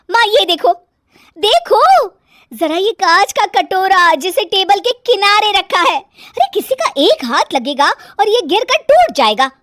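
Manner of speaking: put-on high, loud fussing young wife; high-pitched scandalised nagging; nagging daughter-in-law, alarmed complaint. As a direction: raise a loud fuss over carelessness.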